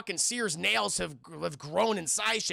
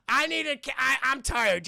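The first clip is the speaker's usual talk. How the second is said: raised, louder mock whine of exhaustion; mock weary complaint; 6 semitones higher, 4 dB louder than usual. Seer telling companions he needs a break, mock weary complaint. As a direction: complain loudly as a worn-out traveler.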